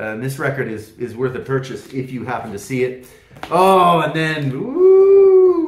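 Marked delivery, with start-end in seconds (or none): 4.5-5.7 high, loud delighted "ooh"; excited ooh. Real, not acted. Host thrilled to reach the next album, high excited ooh. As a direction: squeal with real delight.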